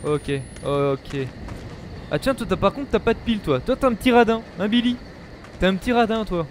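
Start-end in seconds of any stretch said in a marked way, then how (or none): none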